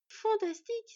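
high, loud delight. Acted. Boy thrilled to face father's killer, delighted. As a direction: burst out with glee. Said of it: "s'épanouit le garçon".

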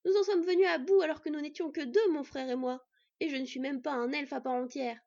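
loud, high angry outburst; angry boy shouting at Odin. Acted. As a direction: shout your disbelief at the old god. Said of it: "le garçon en colère".

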